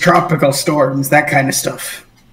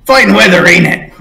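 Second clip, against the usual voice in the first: brisk greeting, a bit higher and louder; cheery sailor small talk; 4 semitones higher, 4 dB louder than usual. Acted. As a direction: toss it off like friendly small talk. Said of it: "turns towards the Torg and goes".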